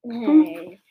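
indignant shout. Real, not acted. Protest at joke jab.

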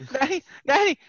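high-pitched mock plea of a little girl; imitated crying child. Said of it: "She's, like, crying".

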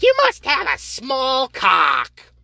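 high, slow shrieked mock of a woman; mocking her. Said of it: "She's like".

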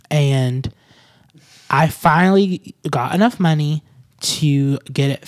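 slow, deliberate declamation of a grievance; mock-formal announcer. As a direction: deliver it as a grand address, dragging out the words.

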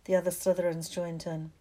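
usual speaking voice

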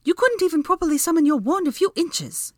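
very high, loud, offended woman; jabbing retort. A narrator's reading. Hermione called clumsy, offended jabbing retort. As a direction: jab back, pitched high with outrage. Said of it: "she jabbed".

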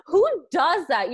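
high, loud, slow disbelief; incredulous. Retold or imitated. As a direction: ask it in shocked disbelief. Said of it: "I was like".

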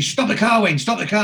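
urgent mock panic to stop the car; panicked shout. Moxie panicking at the police car, yelling to stop.

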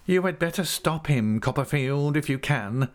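high, urgent character voice; alarmed schemer. A narrator's reading. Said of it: "cried Uriah".

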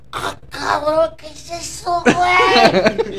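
put-on high, slow, stretched drunk voice; drunk heckler voice. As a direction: drag it out like a wasted heckler. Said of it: "le hace".

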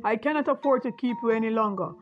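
put-on harsh, loud shout at a beggar child; coarse angry voice. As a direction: bark the order away, rough and loud. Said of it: "spoken in a coarse and angry voice".

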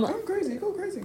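giggly teasing. Real, not acted. Teasing friend, laughing.

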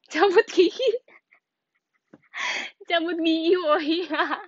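high, loud, through laughter; words squeezed out mid-laugh. Real, not acted. Laughing at comment.

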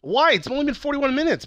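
high-pitched, exasperated objection; indignant protest. Real, not acted. Host objecting to co-host's rushing, indignant. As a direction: start loud and high, then ease off on the last word. Snaps back at impatient co-host.

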